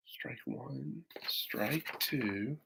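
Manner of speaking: hushed, breathy count of the strikes; whispered aside. A narrator's reading. Narrator whispering the strikes to listening kids. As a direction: whisper it like a tense spectator.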